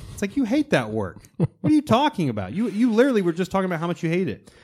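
high, fast incredulous scolding; worked-up mock rebuke; exasperated. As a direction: call him out, baffled.

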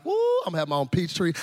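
quick, giddy woo then boast; quick burst of retold glee; retold excitement. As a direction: act out a mildly excited child. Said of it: "I am so excited about this peach tree".

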